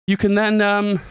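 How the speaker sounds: breathless, gasping between words; out of breath. Real, not acted. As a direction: talk while winded. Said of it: "I'm so out of breath".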